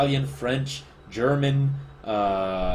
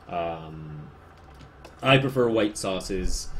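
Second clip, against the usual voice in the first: usual speaking voice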